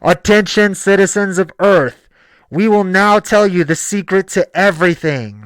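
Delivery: mock alien announcer over a loudspeaker; loudspeaker announcement. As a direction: announce it loudly to the whole planet, stiff and formal. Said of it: "aliens didn't just come down here with loudspeakers".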